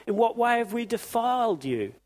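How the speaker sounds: high, whiny protest; mock indignant priests. Preacher mimicking priests protesting to God, high and whiny. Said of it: "But you say".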